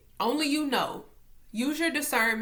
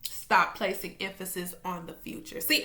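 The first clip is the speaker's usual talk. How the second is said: ordinary delivery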